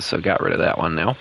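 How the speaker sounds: ordinary delivery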